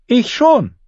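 put-on very high-pitched girl's retort; defiant girl. A narrator's reading. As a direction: pipe up to contradict the bully. Girl defiantly answers the taunt.

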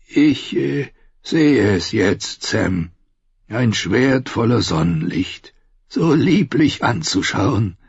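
put-on slow, halting old man; gentle old maester. Blind maester pictures the sword, slow and tender. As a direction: savour it slowly, like an old man.

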